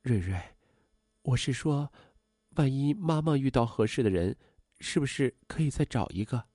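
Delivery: soft, hesitant mother feeling her way; slightly embarrassed. A narrator's reading. Mother tentatively asks daughter about finding someone, embarrassed. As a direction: ask it shyly, with pauses. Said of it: "有些不好意思地说".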